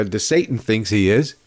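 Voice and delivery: ordinary talk, nothing marked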